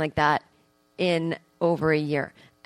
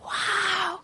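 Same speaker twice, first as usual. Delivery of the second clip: mock wow; 12 semitones higher, 5 dB quieter than usual. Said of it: "I'm like".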